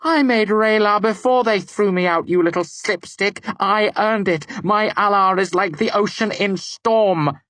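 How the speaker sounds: high, loud furious tirade; raging. A narrator's reading. Devi raging at the narrator who dared bind her. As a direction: unleash her pride and rage. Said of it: "her face a mask of rage".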